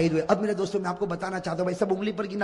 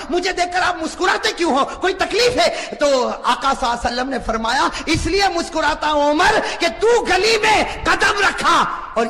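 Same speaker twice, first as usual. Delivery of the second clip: high, loud preaching voice; full-force preaching; 9 semitones higher, 7 dB louder than usual. Acted. Preacher telling a tale of Umar to the crowd, full-force preaching. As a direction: drive the story home to the crowd.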